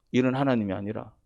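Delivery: ordinary talk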